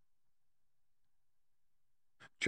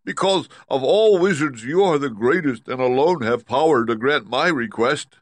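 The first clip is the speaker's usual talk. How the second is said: high, loud lion voice; insistent Cowardly Lion; 7 semitones higher, 7 dB louder than usual. Acted. Lion answering Oz's demand, high and insistent. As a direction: press the plea loudly, pitched up.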